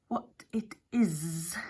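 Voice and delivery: slow, acid whisper of a snake. A narrator's reading. Snake Hex sneering at the ram, slow acid whisper. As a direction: hiss each word slowly with contempt. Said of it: "said Hex acidly".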